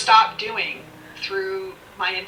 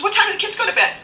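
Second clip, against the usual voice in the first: higher, pointed mock question; imitating the woman; 11 semitones higher, much faster than usual. Sponsor quizzing her about the kids' bedtime, higher mock question. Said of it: "She says".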